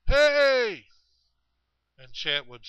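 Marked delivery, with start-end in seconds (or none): 0.1-0.9 high, loud drawn-out holler. Retold or imitated. Unseen hunter calling after Chet, hollering high and loud. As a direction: holler it out to someone far off. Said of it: "hollering at him".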